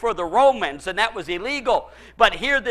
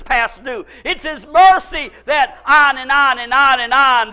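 loud, high, exultant sermon delivery; impassioned preaching; 5 semitones higher, 8 dB louder than usual. Real, not acted. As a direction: drive it home to the congregation with laughter breaking in. Preaches at full force.

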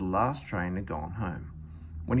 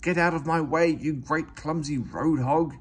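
hissed; 4 semitones higher, 4 dB louder than usual. A narrator's reading. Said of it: "he hissed".

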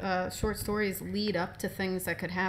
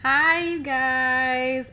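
drawn-out greeting; 4 semitones higher, 7 dB louder, much slower than usual. Acted. Returning guest greets the listeners.

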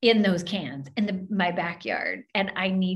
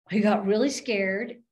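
ordinary talk, nothing marked

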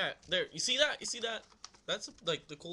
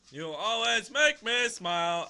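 loud, slow, drawn-out recital; hammed-up sing-song love letter; funny letter reading; 7 semitones higher, 13 dB louder, slower than usual. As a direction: stretch the gushing words right out. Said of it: "I read them a funny way".

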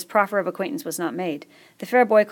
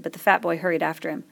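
ordinary delivery

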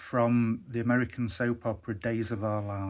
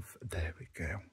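whispered under the breath; 5 semitones lower, 10 dB quieter than usual. Real, not acted. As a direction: whisper it to yourself. Quiet aside while starting toy.

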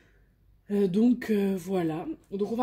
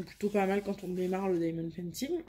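ordinary talk, nothing marked

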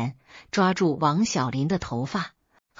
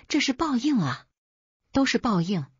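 put-on high, crying wail of an old mother-in-law; mother-in-law wailing through her tears; 6 semitones higher than usual. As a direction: cry it out as a lament, pitched up. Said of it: "我婆婆痛哭流涕，喊着".

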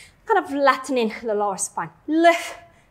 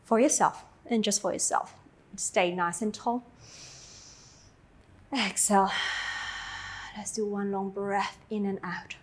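calm cool-down; 4 semitones lower, 11 dB quieter than usual. Acted. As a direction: ease them into rest gently. Cool-down closing, soft and slow.

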